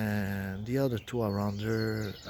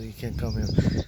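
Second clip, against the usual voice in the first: louder, firm, flat command; firm loud warning; 7 dB louder than usual. Retold or imitated. Priestess blocking the speaker's way, firm loud warning. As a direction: stop him hard at the line. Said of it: "Uh, not like that".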